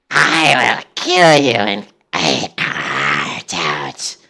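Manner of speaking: slow, heavy monster growl; menacing troll voice. Shown as a demonstration. Streamer demonstrates how a troll should sound, slow and menacing. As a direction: drag every word out like a brute. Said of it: "a troll should sound like".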